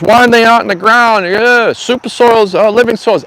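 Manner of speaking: lampoon a smug viewer's advice; imitating a preachy commenter with mock superiority. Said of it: "the other comment is".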